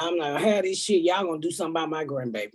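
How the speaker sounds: fast, clipped retelling of cussing out the school; rapid retold rant. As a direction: rattle it off fast like telling off the school. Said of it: "I'm like".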